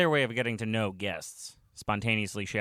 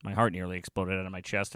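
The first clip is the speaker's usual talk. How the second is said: usual speaking voice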